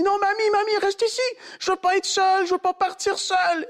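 high, desperate plea; frightened pleading. Retold or imitated. Scared boy begging grandmother to stay, high and desperate. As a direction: beg her not to leave you alone.

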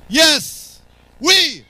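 high-pitched exultant shouts; crying out. Real, not acted. Preacher affirming that one can cry out in church, exultant shouting. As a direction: fire off each word as a high cry. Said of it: "Il a crié d'une voix forte".

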